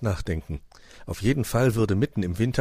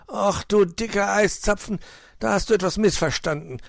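high, irritated scolding; mildly grumpy fox putting a child right; grumbling; 13 semitones higher, 4 dB louder than usual. A narrator's reading. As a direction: grumble with raised pitch. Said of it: "knurrte Herr Fuchs".

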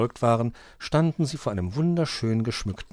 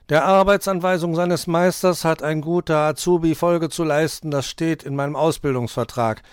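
louder, higher proud recital; visibly proud; 7 semitones higher, 8 dB louder than usual. A narrator's reading. Dominik explains his apprenticeship contract to the fox, proudly. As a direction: recite the rule with pride. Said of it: "der sichtlich stolz war".

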